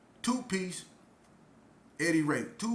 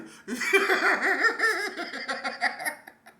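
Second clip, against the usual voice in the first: loud high cackle; uncontrollable laughter; 14 semitones higher, 7 dB louder than usual. Real, not acted. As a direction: crack up all over, helplessly. Laughs again after trying to stop.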